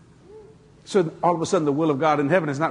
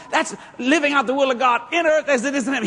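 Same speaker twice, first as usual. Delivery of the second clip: normal talking voice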